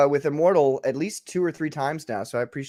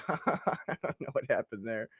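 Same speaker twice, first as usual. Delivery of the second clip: ordinary talk, nothing marked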